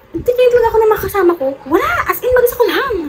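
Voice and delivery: excited disbelief. Real, not acted. Alone at the exam, retelling.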